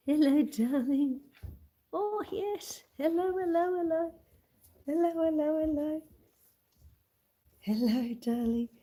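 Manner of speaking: high, cooing sing-song hellos to a dog; doting pet talk. Real, not acted. Owner greeting her sleepy puppy on stream, high cooing pet talk.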